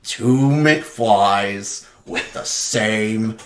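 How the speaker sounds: very slow, spaced-out villain impression; menacing movie villain. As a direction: savour the threat word by word. Imitates villain cocking the gun.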